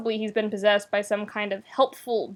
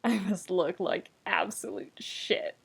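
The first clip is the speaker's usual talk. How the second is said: dry, hushed self-mocking line; wry whisper; 2 semitones lower, 9 dB quieter than usual. Shiro to Keith about his looks, wry hush. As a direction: murmur it with a tired wry edge. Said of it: "he says wryly".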